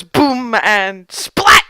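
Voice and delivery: loud, punched impact words; explosive sound words. Acted. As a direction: hit each noise like a blast. Game master voices the spell's impact.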